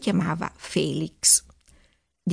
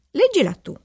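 high, louder princess's order; giving an order; 7 semitones higher, 4 dB louder than usual. A narrator's reading. Princess orders her lady-in-waiting to read, commanding. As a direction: hand the chore over in a higher voice. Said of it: "ordinò alla damigella Margherita".

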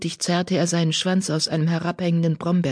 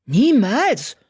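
loud, higher, emphatic denial; forceful, raised retort; Blaustern retorting; 7 semitones higher, 5 dB louder than usual. A narrator's reading. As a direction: reject it outright. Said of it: "gab Blaustern zurück".

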